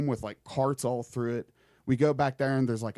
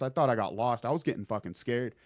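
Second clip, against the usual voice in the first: usual speaking voice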